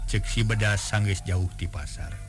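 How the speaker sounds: plain reading voice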